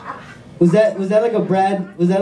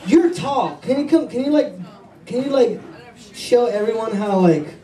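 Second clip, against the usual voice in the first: normal talking voice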